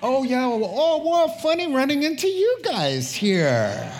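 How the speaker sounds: high, loud, caught-out laughing voice; sheepish fake innocence. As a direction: play it like you've been caught spying. Spies caught, acting innocent.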